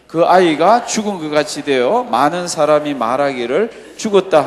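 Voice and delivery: loud, slow, declamatory Bible reading; scripture reading. Acted. Preacher leading the congregation through the healing, loud scripture recitation. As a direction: lead the congregation through the passage at full volume.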